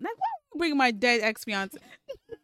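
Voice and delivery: loud, high mock outrage; indignant protest. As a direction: protest as if hit below the belt. Acted son protests mother's low blow.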